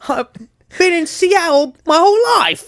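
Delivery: high, loud silly character voice; high, loud goofy voice. Pretend. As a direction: ham up a loud, high local yokel. Playing along with the accent bit.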